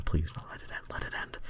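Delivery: please no dropping to a whisper; pleading, trailing off into a whisper; fading whisper plea. Real, not acted. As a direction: beg it to stop, fading to nothing. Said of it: "reader begging the text to stop".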